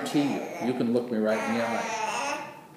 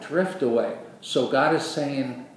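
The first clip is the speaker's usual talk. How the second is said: ordinary delivery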